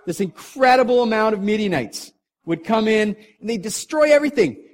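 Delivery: laughing excitement at the raid; excited. A narrator's reading. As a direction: build the raid with glee. Laughing while building story.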